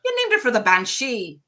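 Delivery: lightly voiced old Irish grandmother brogue; a hint of an Irish accent, aghast; mild Irish brogue, scandalised at the name. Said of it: "in her Irish brogue".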